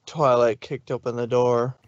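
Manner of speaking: ordinary delivery, nothing marked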